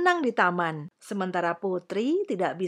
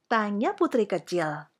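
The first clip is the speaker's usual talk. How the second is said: usual speaking voice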